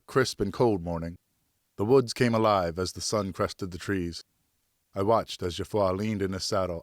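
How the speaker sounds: plain reading voice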